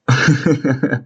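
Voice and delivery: short nervous laugh. Real, not acted. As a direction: laugh out of nerves. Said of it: "c'est un rire nerveux".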